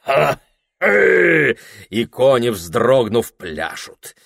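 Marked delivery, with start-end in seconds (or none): 0.0-1.6 high loud joyful whoop. Acted. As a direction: whoop out happily. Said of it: "Он радостно кричит".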